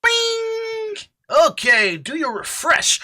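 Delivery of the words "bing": high sung bing sound; mock notification ding. Real, not acted. Streamer imitating a notification chime, high. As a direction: chime it like an alert.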